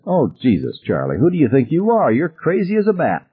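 high, swooping disbelief; incredulous, pitch leaping up; wild incredulity. A narrator's reading. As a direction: scoff at someone who seems crazy. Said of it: "Ted laughed wildly".

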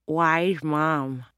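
talking through a mouthful of pizza; words muffled by a bite of food; mouth full. Acted. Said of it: "said with her mouth full".

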